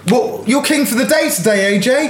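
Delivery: high, bouncy host voice; game show host. Acted. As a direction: hype up the contestant. Host voice continues.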